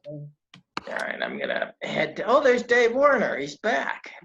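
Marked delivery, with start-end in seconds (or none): 0.1-2.2 quick whispered aside; whispering. Real, not acted. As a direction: whisper it under your breath. Aside while the guest is on.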